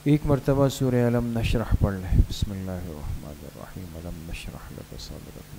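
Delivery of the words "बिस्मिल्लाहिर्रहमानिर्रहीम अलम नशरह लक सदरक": soft, low prayer murmur under the breath; murmured recitation. Acted. Teacher leading students in quiet recitation, murmured softly. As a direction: recite it quietly to yourself, inward.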